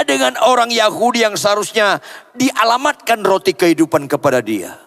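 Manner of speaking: high, loud pulpit proclamation; full-force preaching. Real, not acted. Preacher leading the congregation into verse twenty-seven, proclaiming. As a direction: proclaim it loudly to the whole congregation.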